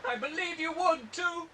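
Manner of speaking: normal talking voice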